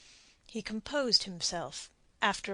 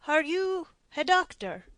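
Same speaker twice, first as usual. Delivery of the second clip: a raised, higher voice for the puzzled don; lightly voiced Spanish captain, curious; intrigued Spaniard; 8 semitones higher, 5 dB louder than usual. A narrator's reading. Puzzled prisoner asks his captor, lightly voiced. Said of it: "the intrigued Spaniard spoke".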